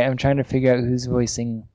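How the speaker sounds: normal talking voice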